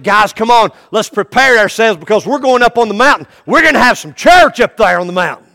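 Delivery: loud, eager call to the disciples; rally the guys, fired up; excited Jesus. Acted. Jesus rallying his disciples to the mountain, loud and eager. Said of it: "See, he was excited about that".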